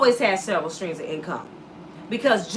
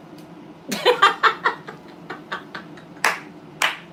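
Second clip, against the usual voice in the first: airy laugh; 12 semitones higher than usual. Real, not acted. Laughing at own joke.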